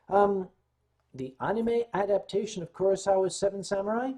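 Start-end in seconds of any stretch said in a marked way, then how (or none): none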